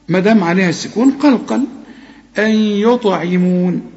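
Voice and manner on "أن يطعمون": أن يطعمون slowly, held "ayy" and bounced T; merging and qalqala. Shown as a demonstration. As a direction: bounce the ط in يطعمون after a long merged أن. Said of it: "وقلقل الطاء".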